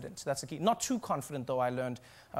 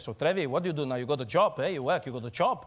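a hint of German accent; dad quizzing his son in a German accent; lightly voiced Swiss German father; 4 semitones lower than usual. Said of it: "He looks at me and he says".

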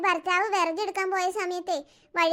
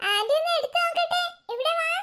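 very high, cooing invitation; coaxing baby-talk; 8 semitones higher than usual. Acted. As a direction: coax a little child over in a high voice. Aunt coaxes child to come. (കുഞ്ഞിനെ കൊഞ്ചിച്ച് വിളിക്കുന്ന ഉയർന്ന ശബ്ദം)

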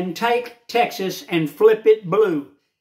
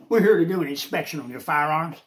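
lightly voiced official, lower and fast; mock inspector voice; 4 semitones lower, faster than usual. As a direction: play the stiff agent at your door, quick and low. Mimicking government inspectors at door.

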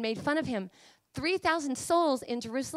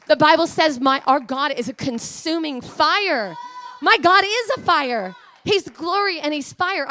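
loud, raised, fiery proclamation; full-force preaching; 4 semitones higher, 8 dB louder than usual. Real, not acted. Preacher declaring that God is a consuming fire, fiery and loud. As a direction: hammer out each phrase to the congregation.

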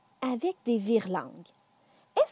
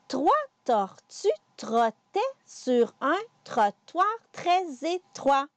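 slow careful articulation; slower than usual. Shown as a demonstration. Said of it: "On recommence".

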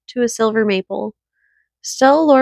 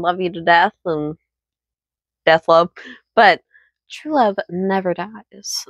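tender and soft; 5 semitones lower than usual. Shown as a demonstration. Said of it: "And as I say".

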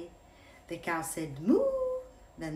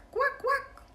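dog quacking. Acted.

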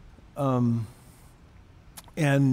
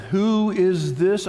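slow, higher, grandly proclaimed challenge; attempted God voice; 5 semitones higher, 4 dB louder, much slower than usual. The Lord answering Job from the whirlwind, attempted God voice. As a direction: draw it out like a voice from the whirlwind. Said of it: "I wish I had the God voice".

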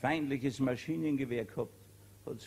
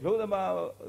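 the sergeant calls a name, louder and higher; barked name; 5 semitones higher, 5 dB louder than usual. Acted. Sergeant summoning the soldier on guard, loud call. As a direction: call him out sharply. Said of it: "hat er gesagt".

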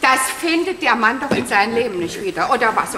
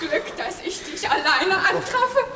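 upset; 7 semitones higher than usual. Acted. Distraught woman bursting in.

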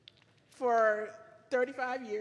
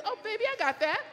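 high-pitched, eager offer of help; gracious gentleman; 8 semitones higher, faster than usual. Retold or imitated. Wife voicing the ideal gentleman offering help, eager. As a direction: pipe up like a doting gentleman. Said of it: "A gentleman just says".

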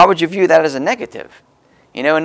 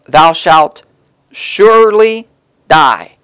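louder, solemn reading with long pauses; scripture reading; 4 dB louder than usual. Acted. Preacher reading the warning verse to the congregation, loud and solemn. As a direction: lean hard on every word of the warning.

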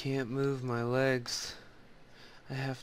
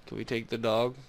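ordinary talk

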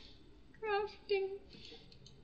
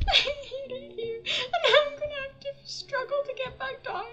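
laugh-crying; 10 semitones higher, 4 dB louder than usual. Real, not acted. Frustrated player laughing at her bad luck. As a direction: dissolve into laughter.

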